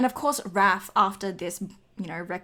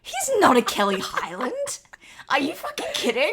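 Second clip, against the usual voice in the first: loud, higher-pitched playful indignation; incredulous outrage; 4 semitones higher, 5 dB louder than usual. Real, not acted. Co-host disputing a friend's silly comparison, incredulous outrage. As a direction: protest it loudly in disbelief. Comic quarrel over a comparison.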